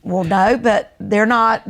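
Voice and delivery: ordinary talk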